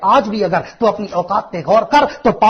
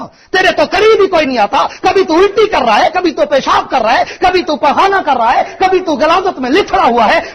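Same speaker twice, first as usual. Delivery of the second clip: loud, high, impassioned sermon voice; full-force preacher's shout; fiery preaching; 6 semitones higher, 6 dB louder than usual. Acted. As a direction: preach it out, building to a cry.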